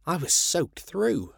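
ordinary delivery, nothing marked

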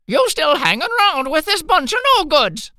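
high, loud scolding mother. Acted. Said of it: "she scolded".

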